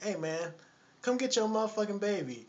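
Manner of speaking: high mock nagging woman's voice. Lady tells the man to take the baby, high mock nagging. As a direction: imitate a fed-up woman ordering him.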